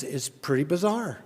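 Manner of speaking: ordinary talk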